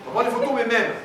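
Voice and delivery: loud, high interruption; calling for a pause. Real, not acted. Speaker halts the audience mid-answer, calling out loudly. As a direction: cut in loudly to hold the room. Halting the noisy room.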